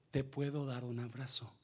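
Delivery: soft, quiet, caring question; gentle offer. Retold or imitated. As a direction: offer the hug softly, like a kind nurse. Nurse gently offers a hug.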